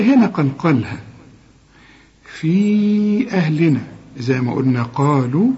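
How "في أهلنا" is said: "في" held long on a steady raised note before "أهلنا"; long madd. Shown as a demonstration. Teacher demonstrating separated madd, long vowel. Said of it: "برضو في المد مد منفصل".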